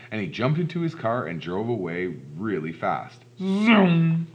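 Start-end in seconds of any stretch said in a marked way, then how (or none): 3.4-4.3 loud, high, drawn-out zoom; zooming car noise. Acted. Policeman's car driving away really fast, loud zooming noise. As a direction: stretch it like a car tearing away.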